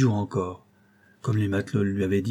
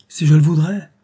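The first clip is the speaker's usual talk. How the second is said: high, loud eager exclamation; crying out; 6 semitones higher, 10 dB louder than usual. A narrator's reading. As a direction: burst out with longing to arrive. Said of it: "s'écria George".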